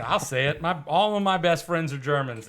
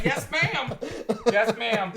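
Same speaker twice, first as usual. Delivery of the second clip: 1 mock obedient yes ma'am; 6 semitones higher, slower than usual. Mock obedient reply to elder.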